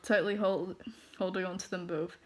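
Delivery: ordinary delivery, nothing marked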